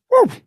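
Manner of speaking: short mimicked woof of a spooked elk; elk bark. Retelling the spooked elk's warning, imitated bark. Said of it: "He barks".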